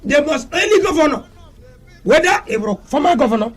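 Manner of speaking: high-pitched, heated tirade, shouting on key words; impassioned shouting rant. Real, not acted. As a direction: rail against the governors with rising fury. Passionate rant against governors.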